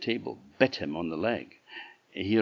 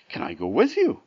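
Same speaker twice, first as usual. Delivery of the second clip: cockerel voice; 6 semitones higher, 5 dB louder, faster than usual. Acted. Cockerel asks.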